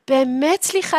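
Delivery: offended gasp, then high huffy apology; mock insulted. Mother whose son told her to stop calling, mock insulted. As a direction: gasp in affront before speaking. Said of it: "היא כל כך נעלבת, she's so insulted".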